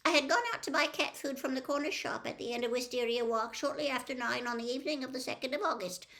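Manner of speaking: fast, high, rote recital; nervous old witness gabbling fast. A narrator's reading. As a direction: rattle it off as if learned by heart. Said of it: "gabbled Missus Figg at once".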